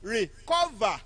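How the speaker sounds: "Recover" with CO lifted; careful demonstration of "Recover", weight on CO; second-syllable stress. Shown as a demonstration. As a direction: raise the middle of "Recover". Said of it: "second syllable".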